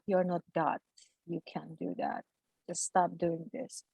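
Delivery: soft, low voice from within, said gently; a hint of a calm, distant voice; hushed inner voice. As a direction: let a quiet voice tell you to stop. An inner voice telling her.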